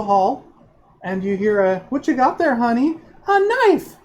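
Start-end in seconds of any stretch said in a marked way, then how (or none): 1.9-3.0 high, sweetly curious parent asking; a mother gently asking what her kid holds; parent's voice. Acted. Mother finding the child's knife, asking sweetly. 3.3-4.0 put-on very high, loud child's yelp; a kid proudly shouting out his find; excited child. Child showing the knife to his mother, loud and high.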